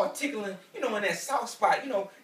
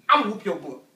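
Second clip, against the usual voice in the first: loud threat; 9 dB louder than usual. Shown as a demonstration. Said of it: "I say it just like that".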